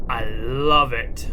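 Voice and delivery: loud, slow gloating relish; gloating villain. Pretend. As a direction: savor the ruin aloud. Relishing destruction.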